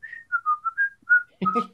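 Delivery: high, cartoonish character chuckle; goofy high-pitched cartoon laugh. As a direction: let out the signature character laugh. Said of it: "I have to do that every time I say Steamboat Willie".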